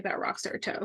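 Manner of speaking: hushed playful whisper. Real, not acted. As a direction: breathe it out as a secret aside. Joking aside about her toe.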